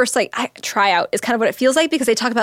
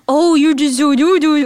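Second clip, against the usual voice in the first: put-on high, loud baby talk; mock cooing baby talk; 5 semitones higher, 5 dB louder than usual. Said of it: "I hate when people are like".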